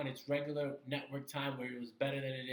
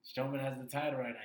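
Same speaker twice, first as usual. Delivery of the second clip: normal talking voice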